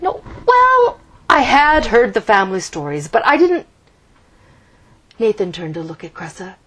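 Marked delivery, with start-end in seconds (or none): 0.0-3.6 flustered. A narrator's reading. Nathan flustered.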